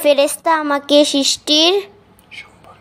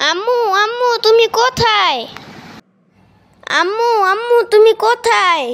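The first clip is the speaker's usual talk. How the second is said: high-pitched, anxious calling out; acted scared child's call, pitched high; frightened cry for mother; 7 semitones higher than usual. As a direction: call out for mum after a nightmare. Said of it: "চিৎকার করে উঠলে কেন".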